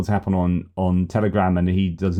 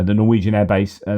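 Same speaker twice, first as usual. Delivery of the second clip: ordinary delivery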